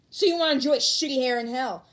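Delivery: loud, snarling threat; furious threat. Acted. Bakugo threatening Kirishima, furious. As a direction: threaten him with full rage.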